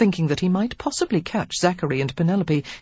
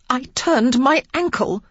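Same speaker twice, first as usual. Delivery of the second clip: loud, high, indignant outburst; furious surprise; 8 semitones higher, 5 dB louder than usual. A narrator's reading. Lily tells the earl of her ankle, furious and loud. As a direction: spit it out, outraged. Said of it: "she said in furious surprise".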